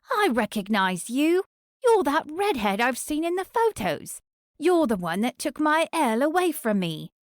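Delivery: a jealous woman spitting out blame; jealous stalker. Acted. Cady confronts the redhead.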